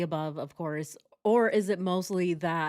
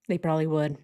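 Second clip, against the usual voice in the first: ordinary talk, nothing marked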